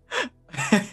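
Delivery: high squealed laugh through words. Real, not acted. Laughing at voiced character.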